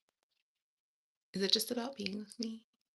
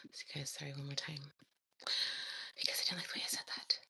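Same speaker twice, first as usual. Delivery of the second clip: fast whispered aside; fast hushed self-critique; whispering; 4 semitones lower, 8 dB quieter than usual. Real, not acted. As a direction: whisper it quickly to the viewers. Hushed comment on her take.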